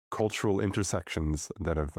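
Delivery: ordinary delivery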